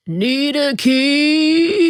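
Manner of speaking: high, loud, drawn-out call; old man calling. A narrator's reading. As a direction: call it out slowly with a Southern accent. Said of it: "the old man calls".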